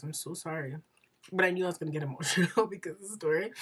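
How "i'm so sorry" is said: tearful apology. Real, not acted. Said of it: "I'm crying so much".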